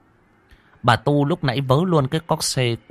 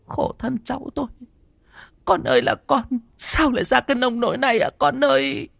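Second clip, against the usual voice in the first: high, quick tearful lament; wailing grandmother; 14 semitones higher than usual. Acted. Grandmother laments over her grandson, high and tearful. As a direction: sob out a grieving lament. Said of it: "Bà Tu và cô Hải mếu máo".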